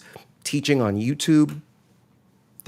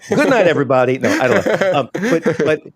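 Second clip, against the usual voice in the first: mock panic sign-off; 5 semitones higher, 7 dB louder than usual. Real, not acted. Mock sign-off after slip.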